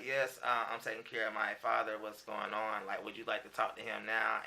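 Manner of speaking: a hint of a tired caregiver on the phone; soft, polite Contessa. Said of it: "And Contessa was like".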